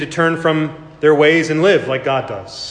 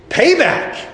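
high sharp cry of payback; mock world's cry; 12 semitones higher than usual. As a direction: spit out the word like the world would. Voicing the world's ethic.

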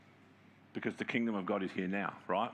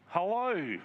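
pointed hello; 8 semitones higher than usual. Real, not acted. Pointed aside after key line.

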